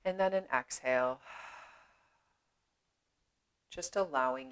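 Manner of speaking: unhurried meditation voice with long pauses; measured, spacious yoga-teacher delivery; guided meditation. Acted. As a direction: guide them gently inward, leaving space after each phrase.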